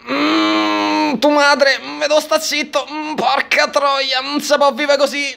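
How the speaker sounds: mock-crying husband, moaning between words; whimpering Ned. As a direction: play Ned swallowing his sobs. Voicing Ned dodging the question.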